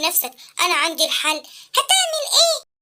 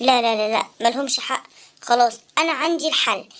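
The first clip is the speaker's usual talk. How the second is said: put-on much lower, gentle old man; wise old inventor voice; 14 semitones lower than usual. Uncle Abqarino asking the sad boy why, low. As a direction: ask like a kindly old uncle.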